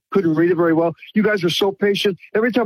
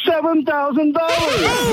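high, drawn-out jubilant shout; thrilled shout; 8 semitones higher, slower than usual. Real, not acted. Caller proudly telling the host his free silver total, thrilled. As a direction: stretch it out like announcing a jackpot.

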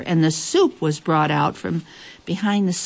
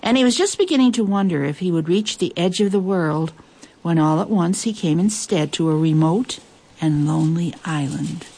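ordinary delivery

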